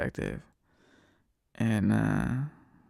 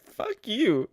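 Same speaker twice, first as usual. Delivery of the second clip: mock retort; 10 semitones higher, 3 dB louder than usual.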